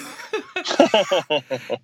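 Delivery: high, loud laugh breaking out at his own punchline; real burst of laughter. Real, not acted. Telling a friend the stock-tips punchline, laughing out loud.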